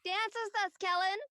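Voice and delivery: high, loud call across the gym. Acted. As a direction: call him down from the bleachers. Calling Kellen from the court.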